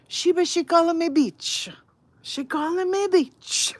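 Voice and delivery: high, loud, whiny complaint; outraged neighbor. Retold or imitated. Neighbor whining loudly to husband about the insult. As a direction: tattle to your husband, outraged.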